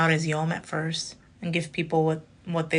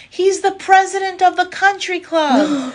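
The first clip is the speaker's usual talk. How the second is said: higher, louder call from the crowd, slowed; crowd heckle; 13 semitones higher, 8 dB louder, slower than usual. Retold or imitated. Audience member calling out the punchline, loud and high. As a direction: shout it out from the back of the room. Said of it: "someone from the crowd goes".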